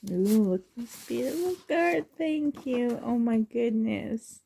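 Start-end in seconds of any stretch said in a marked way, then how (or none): none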